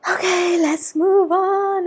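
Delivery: slow, breathless and loud; out of breath. Real, not acted. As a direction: catch your breath while speaking. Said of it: "I'm a little out of breath".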